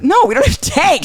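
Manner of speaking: imitated high-pitched disbelief; exasperated uncle. Retold uncle exasperated by kid's questions.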